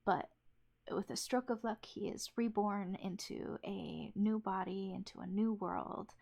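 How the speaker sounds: ordinary delivery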